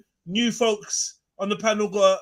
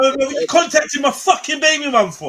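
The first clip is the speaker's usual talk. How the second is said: angry; 7 semitones higher, 5 dB louder than usual. Pretend. Angry ex voiced in role-play.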